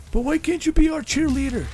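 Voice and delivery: high, whiny mock fan voice; whiny fan mockery. Mocking a gamer who begs him to cheerlead, high and whiny. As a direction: squeak it out like a begging fanboy.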